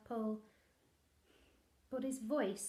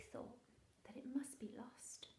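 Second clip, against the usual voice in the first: whisper; 11 dB quieter than usual. Acted. Storybook line dropped to hush.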